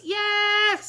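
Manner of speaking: high, loud eager yes; chorus it excitedly; class answering. A narrator's reading. Kids answer the principal, loud and high. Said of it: "said all the".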